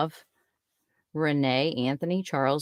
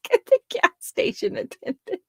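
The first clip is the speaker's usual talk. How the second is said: laughing. Real, not acted. Laughing at own story.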